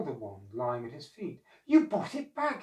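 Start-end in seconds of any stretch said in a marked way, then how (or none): 1.7-2.6 high, louder cry of joy; raised, pleased old man's voice; delighted exclamation. A narrator's reading. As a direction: marvel gladly at a returned treasure. Old man delighted at returned wand.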